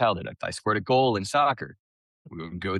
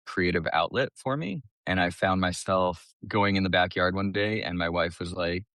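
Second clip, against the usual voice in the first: normal talking voice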